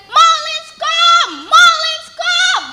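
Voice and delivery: high, loud excited calling out; excited crowd cry. Acted. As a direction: shout the news to everyone. Villagers spot Malin's ship.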